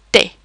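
"te" as French tay, plain T; silent H. Shown as a demonstration. Teacher shows learners T H, "te" with plain T. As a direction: say "te" with only a T, no H. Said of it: "we only pronounce the T".

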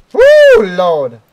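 very high loud whoop; triumphant whoop. Real, not acted. Streamer whooping after a big jump.

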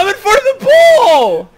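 frustrated shout. Real, not acted. Frustrated teammate yelling mid-game.

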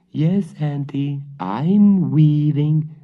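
put-on high, slow squeaky girl voice; squeaky mimicked girl. The cat fakes Marusha's voice to fool the witch. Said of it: "in a squeaky voice, trying to mimic Marusha".

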